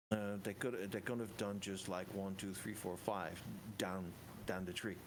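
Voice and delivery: usual speaking voice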